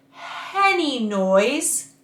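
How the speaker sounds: slow Henny noise, air before the vowel; breathy H onset. Shown as a demonstration. Coach showing an airy h start on Henny noise. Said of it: "Put an H in front of it".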